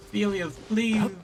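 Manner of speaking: ordinary delivery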